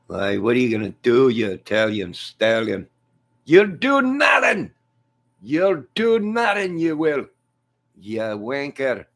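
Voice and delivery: high, loud Irish fighter rant; McGregor. Acted. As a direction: goad your rival in a hammed-up Irish voice. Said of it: "McGregor, don't talk like that".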